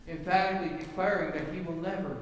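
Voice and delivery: normal talking voice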